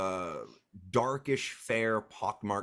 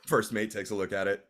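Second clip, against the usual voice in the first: ordinary talk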